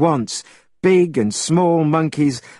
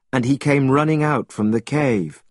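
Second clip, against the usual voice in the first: ordinary delivery, nothing marked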